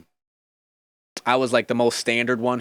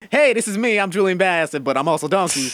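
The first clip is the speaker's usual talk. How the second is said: higher, louder upbeat intro; cheerful self as Donkey; 7 semitones higher, 4 dB louder than usual. Retold or imitated. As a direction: announce yourself with a grin. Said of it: "slipped out of the Eddie Murphy".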